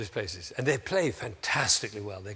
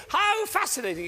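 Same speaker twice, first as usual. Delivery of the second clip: much higher, louder delighted cry; celebrating a mistake; 5 dB louder than usual. Shown as a demonstration. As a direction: toast the blunder with joy. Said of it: "you go".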